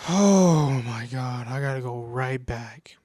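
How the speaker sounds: retold weary exasperation; exasperated dread. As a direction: groan it out, dreading the return. Retelling dread of returning to work.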